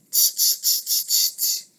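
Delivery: whispered tch tch, soft and teasing; monkeys tutting. Acted. Monkeys tutting back at the angry peddler. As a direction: tut like cheeky monkeys. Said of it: "and said".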